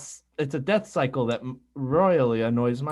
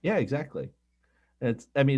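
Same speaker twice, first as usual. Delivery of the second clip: usual speaking voice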